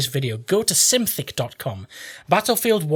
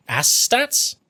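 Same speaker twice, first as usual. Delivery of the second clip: higher questioning repeat of ass stats; rising, amused echo of ass stats; amused double take; 7 semitones higher than usual. Real, not acted.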